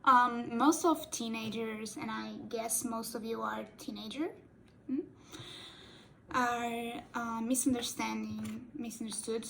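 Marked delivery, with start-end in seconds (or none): none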